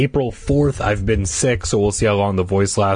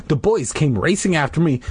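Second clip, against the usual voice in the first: usual speaking voice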